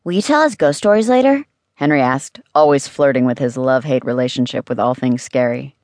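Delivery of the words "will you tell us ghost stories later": put-on high child's voice, hopeful question; high-pitched, keen request; eager kid. A narrator's reading. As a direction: ask excitedly in a high kid voice. Boy eagerly asks for ghost stories.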